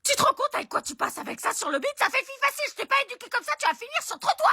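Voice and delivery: mother scolding her daughter, hysterical; hysterical rant. Acted. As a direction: rant it high and outraged.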